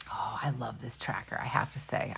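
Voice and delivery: a hushed little gush of delight; delighted whisper. Real, not acted. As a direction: breathe it out like a secret you love. Private delight over the tracker.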